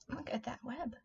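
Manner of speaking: ordinary delivery, nothing marked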